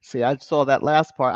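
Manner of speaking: ordinary talk, nothing marked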